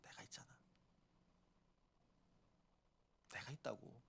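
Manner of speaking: whispered, chest-beating Jesus; a whispered promise from Jesus; hushed Jesus. Retold or imitated. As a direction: breathe it out close and intimate, as Jesus. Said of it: "가슴 팍 치면서 뭐라고요?".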